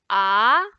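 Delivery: rising "ah", clearly projected; second tone. Shown as a demonstration. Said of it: "second tone is going up".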